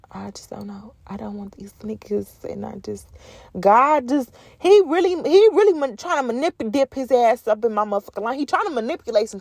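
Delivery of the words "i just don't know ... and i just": whisper. Real, not acted.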